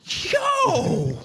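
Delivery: very high, stretched-out retold astonishment; amazed disbelief. Host seeing the cloaked stranger start rapping, amazed disbelief. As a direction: act out being floored, voice shooting up. Retelling shock at surprise rapper.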